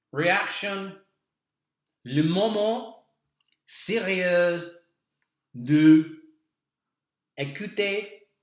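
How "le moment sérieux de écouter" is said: mock French announcer. Mock French intro to listening.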